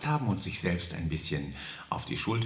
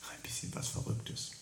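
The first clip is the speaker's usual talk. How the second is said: much softer unvoiced whisper; hushed mischievous whisper; 10 dB quieter than usual. Real, not acted. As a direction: whisper the tease. Whispered teaser at end.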